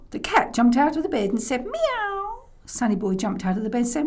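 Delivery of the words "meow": high-pitched cat cry; startled cat meow. Acted.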